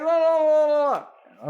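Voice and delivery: high, loud mocking blah blah; dismissive blah. Parent brushing off the therapy advice, mocking and high. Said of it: "They're like".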